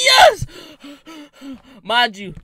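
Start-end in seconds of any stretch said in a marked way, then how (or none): 0.0-0.5 high, loud cry of victory; triumphant shout. Real, not acted. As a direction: yell it like you just escaped.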